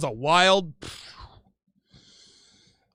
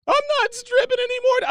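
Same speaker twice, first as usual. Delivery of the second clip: much higher mock girlfriend voice, defensive; imitating girlfriend. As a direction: pitch it way up as her protesting.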